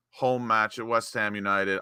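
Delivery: ordinary talk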